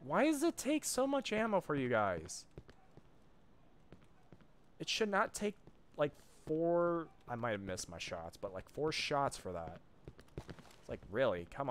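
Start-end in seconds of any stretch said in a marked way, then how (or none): 0.0-7.1 high-pitched, loud whine of protest; whining at the unfairness; exasperated complaint. Real, not acted. Complains about enemies soaking ammo.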